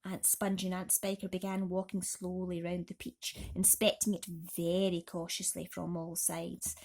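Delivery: plain reading voice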